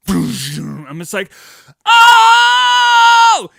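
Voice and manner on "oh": much higher and louder scared shriek; terrified yell. Retold or imitated. Scared kid hears the toy sword sound, shrieking. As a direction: shriek like a startled little kid. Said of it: "it scared the fuck out of me".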